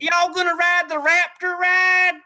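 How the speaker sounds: loud, high mock Southern twang; Southern accent. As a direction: play a hammed-up Southern parent at a theme park. Mocking Southern tourists.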